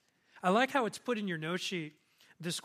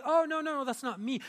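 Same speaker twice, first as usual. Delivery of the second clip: quick, higher-pitched brush-off; mock protest, pitched up; simple denial; 7 semitones higher, 5 dB louder than usual. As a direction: wave off an accusation in a raised voice. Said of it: "But not just simply".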